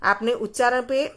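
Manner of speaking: ordinary talk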